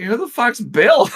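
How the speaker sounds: high, slow baffled outburst. Retold or imitated. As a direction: sound completely lost. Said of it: "we're like".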